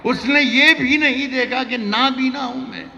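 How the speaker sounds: high, loud declaimed verse; shouted recitation. Acted. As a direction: belt the line to the crowd. Poet recites ghazal.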